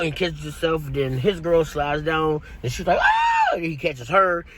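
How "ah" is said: high, loud falling scream; mock falling scream. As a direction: shriek as she slides down. Girl slides down screaming.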